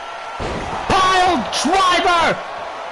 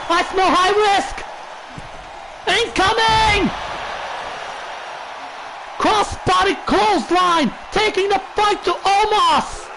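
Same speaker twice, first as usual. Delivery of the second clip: wrestling commentator; 3 semitones higher than usual. Acted. Live play-by-play of a match.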